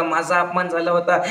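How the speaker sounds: normal talking voice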